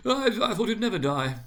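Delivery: high and stumbling, mock relief; high merchant voice. A narrator's reading. Merchant gossiping about the dead man, high voice.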